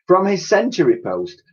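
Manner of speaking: usual speaking voice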